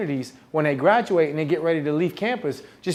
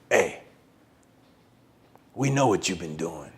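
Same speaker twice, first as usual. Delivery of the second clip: deep menacing friend; 11 semitones lower, 7 dB quieter than usual. Retold or imitated. Big friend catching him in the car, deep and menacing. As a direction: growl it like an intimidating giant.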